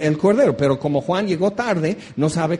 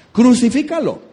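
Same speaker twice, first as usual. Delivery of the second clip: a mock crowd yell, higher; 6 semitones higher, 3 dB louder than usual. Preacher voicing the mob demanding crucifixion, mock yell. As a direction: shout it like a jeering mob. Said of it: "La multitud al rato va a gritar".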